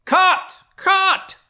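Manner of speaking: sudden high, loud yell across the water; urgent shipboard shout of warning; shouted cry. A narrator's reading. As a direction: bellow it like a sailor calling to the boats. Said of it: "was the cry from the ship".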